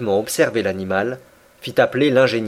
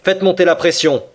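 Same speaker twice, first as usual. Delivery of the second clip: commanding call; 6 semitones higher, 4 dB louder than usual. A narrator's reading. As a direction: order called out, higher and louder. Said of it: "cria-t-il". (ordre lancé plus haut et plus fort)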